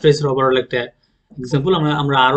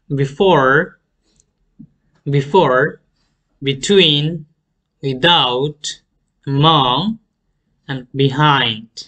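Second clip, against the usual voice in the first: before, between, without leaning on FORE, TWEEN, OUT; second-syllable stress; much slower than usual. Shown as a demonstration. Teacher models two-syllable prepositions, second-syllable stress. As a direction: hit the second syllable of among and behind. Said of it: "second সিলেবলটা আমরা".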